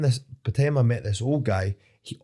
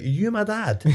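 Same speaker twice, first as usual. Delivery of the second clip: high, incredulous taunt; retold shouting; 12 semitones higher than usual. Driver mocking the man telling him off, retold indignation. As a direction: fling it back in a high, indignant pitch. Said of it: "'cause I was shouting at him".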